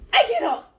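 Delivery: loud, high fangirl outburst; overcome with excitement. Real, not acted. Reader reacting to a love confession, ecstatic outburst. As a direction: explode over the love confession.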